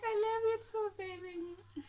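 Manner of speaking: loud, very high mock-sweet squeal; gushing lovey-dovey. Girlfriend answering his love declaration, gushing lovey-dovey. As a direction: squeal it, over-the-top adoring.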